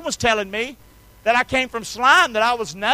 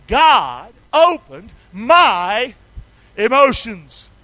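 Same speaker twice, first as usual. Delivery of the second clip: very slow, loud "God opened my"; call and response; 5 dB louder, much slower than usual. Shown as a demonstration. Preacher leading the church in a response, slow and loud. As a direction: drag it out so the room can join. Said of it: "say it with me".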